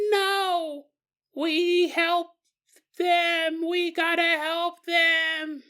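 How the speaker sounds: put-on very high, slow toddler wail; stretched-out squeaky child pleading; little kid voice. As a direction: plead like a desperate three-year-old. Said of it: "let's stop doing that voice".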